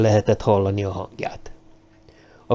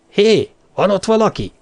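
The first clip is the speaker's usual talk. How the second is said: high call into the dark; 9 semitones higher, 3 dB louder than usual. Acted. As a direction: call out warily into the night. Calling out into bushes.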